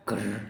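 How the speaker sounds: low, soft rolled growl; villain's growl. Acted. Schemer fuming at the commander's son, muted growl. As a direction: growl under your breath.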